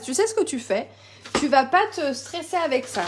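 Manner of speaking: ordinary delivery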